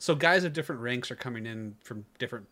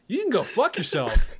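louder, higher acted comeback; retold defiance; 6 semitones higher, 6 dB louder than usual. Third class telling off the higher-ranking guy, defiant. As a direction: throw it back defiantly, as in a story. Retold confrontation in smoking story.

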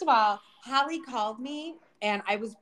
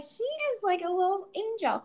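high-pitched adoring gush; 11 semitones higher than usual. Retold or imitated. Client gushing about her sweet shampooer, high and adoring. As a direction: coo it up high in admiration. Gushing over the angelic stylist.

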